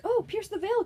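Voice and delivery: light up at the discovery; surprised mom. Retold or imitated. Mom surprised finding band shirt.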